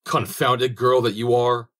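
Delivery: loud, high, slow yell at the bones; angry cry. Acted. Soldier shouting at the dead girl's bones, loud angry cry. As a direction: bellow it in frustration, pitch climbing on get up. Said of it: "cried he".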